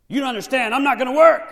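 loud, high defiant protest. Acted. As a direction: insist loudly, refusing. Slave protesting loudly.

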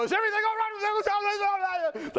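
high, garbled mock alarm shout; guards bursting in. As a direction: burst in with a frantic high call. Guards bound in to subdue.

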